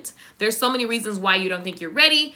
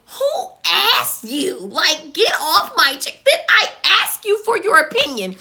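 sassy clapback; 5 semitones higher, 4 dB louder than usual. Acted. Snapping back at commenters.

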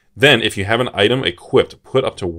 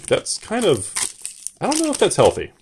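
higher, light quizzical inner voice; mock concern; 10 semitones higher, 4 dB quieter than usual. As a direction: playfully imitate her own puzzled reaction. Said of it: "I was like".

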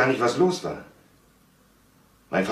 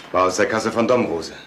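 normal talking voice

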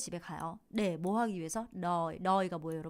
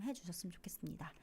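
whisper; 3 semitones lower, 11 dB quieter, faster than usual. Real, not acted. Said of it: "unvoiced whisper".

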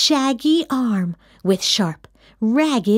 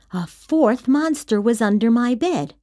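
ordinary delivery

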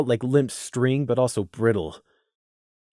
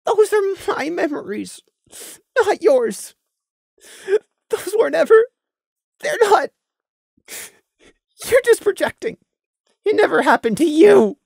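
much higher, strained voice; forcing words past a lump in the throat; choked insistence; 6 dB louder than usual. Acted. Prey tearfully insisting to Lemon they're his memories.

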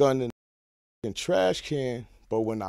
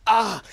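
retold yell of pain; 11 semitones higher, 8 dB louder than usual. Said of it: "I'm like".

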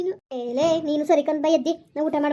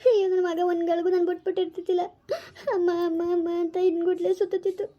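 crying; 3 semitones higher than usual. Acted. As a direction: wail for your child through acted sobs. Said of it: "ಅಳ್ಬೇಡ, ನಿನ್ ಧೈರ್ಯವಾಗಿರು". (ಬಿಕ್ಕಿ ಅಳುತ್ತಾ ಗೋಳಾಟ)

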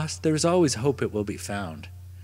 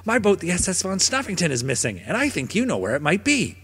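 dog voice; 6 semitones higher than usual. Acted. Dog confronts otter.

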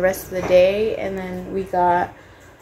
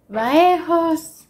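New York landlord; 8 semitones higher than usual. Retold or imitated. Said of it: "pretending to be a New York landlord".

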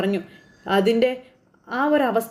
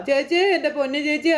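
higher, hammed-up sobbing lament; fake crying; 4 semitones higher than usual. Uncle fake-crying over sister in hospital. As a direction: wail it like a staged mourner. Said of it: "ഭയങ്കര കരച്ചിൽ".

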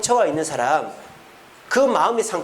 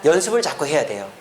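normal talking voice

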